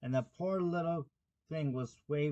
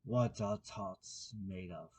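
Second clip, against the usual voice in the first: low, slow, deep-voiced king; 5 semitones lower, 4 dB quieter, slower than usual. A narrator's reading. King frowning at the cook, low and slow. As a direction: drop the voice low and take it slowly. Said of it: "he said in a deep voice".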